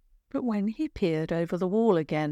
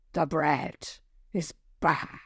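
low, slow troll rasp; 3 semitones lower, slower than usual. Acted. Troll condemning the girls' baking in a rasp. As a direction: judge the bread like a grim old troll. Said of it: "a loud rasp".

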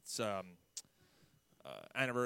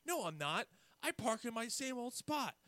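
higher, lightly voiced calm old boss; calm denial; 11 semitones higher than usual. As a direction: deny it calmly and plainly. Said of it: "he was very calm".

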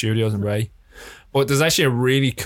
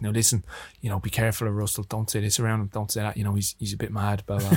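softer, lower word of caution; hushed warning; 3 semitones lower, 7 dB quieter than usual. Retold or imitated. Crew quietly warning a newcomer about the star, hushed warning. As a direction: confide a warning under your breath.

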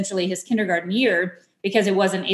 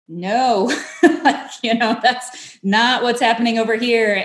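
laughing through it; 3 semitones higher, 3 dB louder, slower than usual. Retold or imitated. Laughing while quoting herself.

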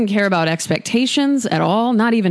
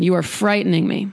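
ordinary talk, nothing marked

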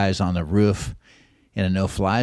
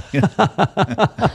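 a short high burst of laughter; 11 semitones higher, 5 dB louder than usual. Real, not acted. Guest laughing at the end of his rehab story, high laugh. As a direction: laugh at your own punchline.

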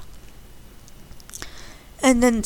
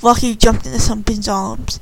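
usual speaking voice

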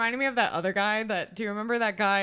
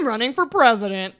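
loud, proud announcement; lightly imitated candidate declaring; Hillary Clinton; 8 dB louder than usual. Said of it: "Then she comes in and goes".

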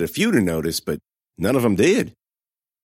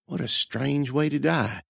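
dazed thought; 4 semitones higher, 5 dB quieter, slower than usual. A narrator's reading.

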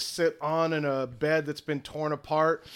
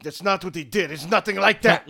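defensive snap; 6 dB louder than usual. Acted. NPC defensive, offended.

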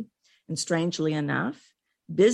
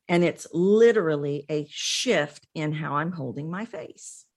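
ordinary delivery

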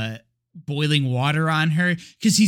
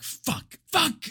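soft curse, then a higher one; mock upset cursing; 8 semitones higher, 5 dB quieter than usual. Exorcist learns his partner knows the name, mock upset. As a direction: imitate frustrated swearing. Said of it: "visibly upset".

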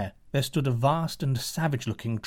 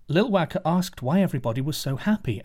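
ordinary delivery

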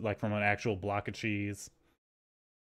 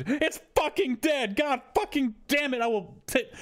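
imitating grandma's much higher cursing outburst; 14 semitones higher than usual. Grandmother over the knocked plant, high mock cursing. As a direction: squeal the curses up high like an outraged grandmother. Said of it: "she actually said".

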